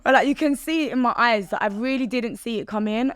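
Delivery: ordinary talk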